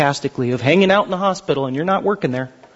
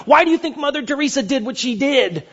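ordinary delivery

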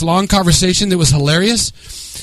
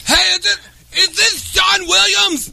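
high, drawn-out caricature voice; high-pitched impression of a buddy; Dennis; 12 semitones higher than usual. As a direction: put on a much higher, slower character voice. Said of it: "So go do Dennis".

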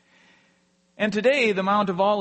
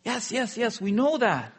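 higher-pitched, hurried mock impatience; impatient dismissal; 7 semitones higher than usual. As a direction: brush it off as the retold sisters. Sisters brush off Jesus' reminder.

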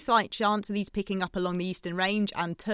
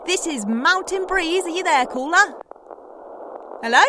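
louder, pushing through a dead line; calling out; 6 semitones higher, 5 dB louder than usual. Real, not acted. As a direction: raise the voice to find the caller. Calling to a silent caller.

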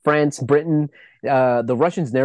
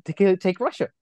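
baffled question; 6 semitones higher than usual. Retold or imitated. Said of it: "makes you go like".

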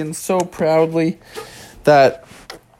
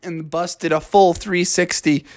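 ordinary delivery

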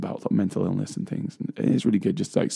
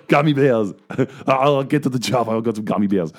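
a hammed-up Austrian Schwarzenegger accent, higher and louder, through laughter; laughing while doing a mock Schwarzenegger accent; Arnold Schwarzenegger accent; 3 semitones higher, 4 dB louder than usual. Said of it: "in an Arnold Schwarzenegger accent".

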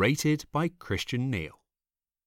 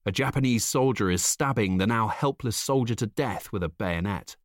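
usual speaking voice

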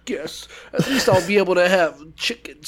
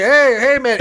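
loud casual greeting, higher; loud cheery greeting; 6 semitones higher, 6 dB louder than usual. Retold or imitated. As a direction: call out cheerfully. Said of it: "He's like".

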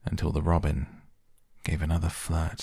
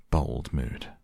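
usual speaking voice

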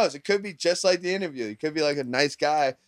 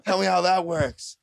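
higher-pitched, indignant retold tirade; mock angry rant; 6 semitones higher, 3 dB louder than usual. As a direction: imitate a wronged cook blowing up. Acts out film character's angry rant.

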